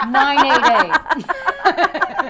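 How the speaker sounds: high, loud laughter through the words; laughing fit. Real, not acted. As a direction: get the number out while cracking up. Laughing fit on the hotline number.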